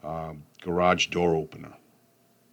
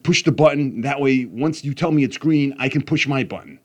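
higher, louder retold yell; 6 semitones higher, 4 dB louder than usual. Dad yelling instructions to son in the garage. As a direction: bark the steps at the kid. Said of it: "So I'm yelling at him".

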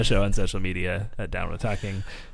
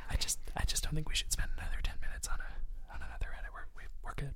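soft unvoiced whisper; 5 semitones higher, 10 dB quieter than usual. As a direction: keep it hushed. Group whispering.

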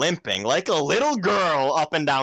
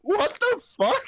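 high, slow, baffled what the fuck; a high slow yelp of surprise; high disbelieving cry; slower than usual. Retold or imitated. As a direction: squeal it in disbelief. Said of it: "I'm like".

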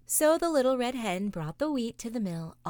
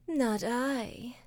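sleepy cat purring; 5 semitones higher than usual. Acted. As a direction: purr it like a drowsy cat. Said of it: "purred the sleepy cat".

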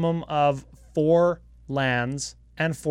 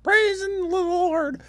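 mock rejoicing; slower than usual. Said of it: "everybody was like".

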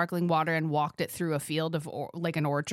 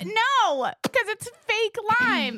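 mock high voice; 13 semitones higher, slower than usual. Mocking the fake lime.

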